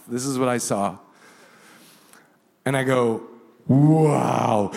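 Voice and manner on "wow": awed wow. Retold or imitated. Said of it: "the word came flying out of my mouth".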